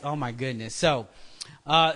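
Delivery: usual speaking voice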